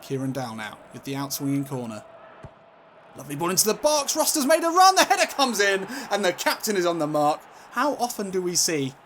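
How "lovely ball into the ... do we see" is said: high, loud football commentator shout; excited goal call. Real, not acted. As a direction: build fast to a soaring goal cry.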